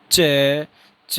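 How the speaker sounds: crisp "च" twice, closure then slow release; pron demo. Shown as a demonstration. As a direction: model "च" for the class to repeat. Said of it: "फिर से एक बार बोलना".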